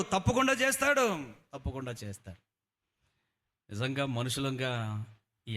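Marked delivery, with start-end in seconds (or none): 0.0-1.3 high, loud certain cry; loud high sermon cry; loud affirmation. Acted. Repeated promise, loud then hushed. 1.5-2.5 soft, dropped-down echo; hushed affirmation. Acted. Repeated promise, loud then hushed.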